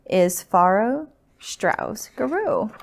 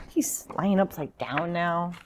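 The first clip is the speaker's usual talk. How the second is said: usual speaking voice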